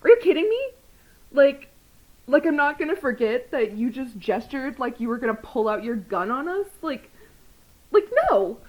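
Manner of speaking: loud, high incredulous outburst; retold outrage. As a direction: confront the cop in disbelief. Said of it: "And I'm just like".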